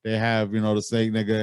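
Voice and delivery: ordinary talk, nothing marked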